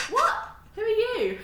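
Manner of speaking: high, loud mock bafflement; baffled "who on earth?" with a laugh; retold disbelief. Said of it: "I'm like".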